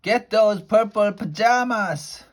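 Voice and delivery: a furious queen screaming at her soldiers. Acted. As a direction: scream it after the fleeing boy. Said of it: "Queen Dentina screaming".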